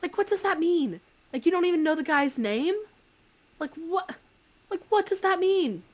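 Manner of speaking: high, baffled questions; can't believe what she heard; incredulous. Retold or imitated. Narrator baffled by the intruder's excuse, incredulous. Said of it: "And I was like".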